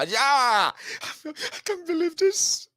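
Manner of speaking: high, slow laughing disbelief. Retold or imitated. Playing a fan in 2015, laughing disbelief. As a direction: giggle like you can't believe it.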